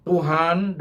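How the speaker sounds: ordinary talk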